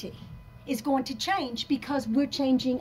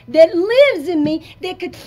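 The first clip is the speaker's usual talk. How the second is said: much louder, slow and menacing; loud warning; 6 semitones higher, 13 dB louder than usual. Real, not acted. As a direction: let the beast growl out slowly. Warning of inner beast.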